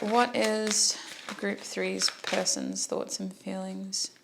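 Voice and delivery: ordinary delivery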